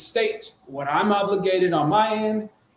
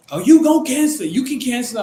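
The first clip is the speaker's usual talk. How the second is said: mimicking her, high and loud, fast; mock shrill seller; 6 semitones higher, 6 dB louder, faster than usual. Seller interrupting the agent, mocked high and fast. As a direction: ham up the seller snapping back.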